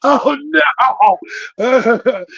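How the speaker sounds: high, loud "oh my God, oh no"; hammed-up childish panic; shocked kids. Boys panic at mom's news, high mock shriek. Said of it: "We're like".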